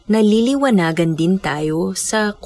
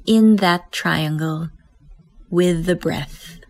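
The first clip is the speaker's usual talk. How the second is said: guided meditation. Acted. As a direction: guide them gently into stillness. Leads breath meditation.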